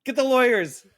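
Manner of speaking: high, loud hammed-up panic; play-acted outcry, calling for backup; mock accusing yell. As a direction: shriek like a squabbling industry exec. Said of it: "pointing fingers at each other like".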